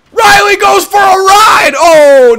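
high, long, drawn-out yell; gleeful shouted call. Real, not acted. Gamer calling his big hit on Riley, gleeful shout. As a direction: stretch the commentator's shout at full volume.

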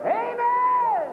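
high, loud exultant amen; preacher lifting the room, shouting amen; shouted amen. Real, not acted. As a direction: throw the amen out to the congregation.

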